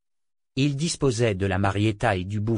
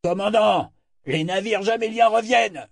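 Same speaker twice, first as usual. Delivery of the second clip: lookout shout; 11 semitones higher than usual. A narrator's reading. Lookout alerting the commander to returning ships, shouting high. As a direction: shout the warning across the deck. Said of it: "une vigie s'écria".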